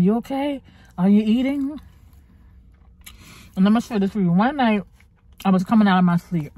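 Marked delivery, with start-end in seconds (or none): 0.0-1.8 concerned doctor. Retold or imitated. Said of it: "My doctor was like".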